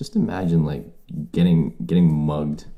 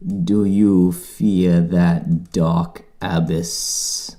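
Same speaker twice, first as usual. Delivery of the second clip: slow, drawn-out mock villain line; a movie villain threatens a prisoner, slow mock menace; Davy Jones menace; much slower than usual. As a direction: stretch each word like a brooding sea captain. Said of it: "Davy Jones".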